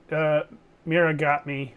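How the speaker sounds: ordinary talk, nothing marked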